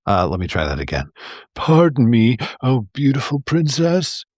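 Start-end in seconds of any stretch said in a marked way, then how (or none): 1.5-4.2 pleading, pitiful little frog; milder pathetic plea, tried once more; a second, less squeaky pathetic plea; sad and pathetic. A narrator's reading. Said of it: "he said in his most sad and pathetic voice".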